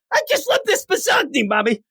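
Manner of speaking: put-on very high childish voice; gushing little boy. Little boy praising Mommy's cooking, high childish gushing.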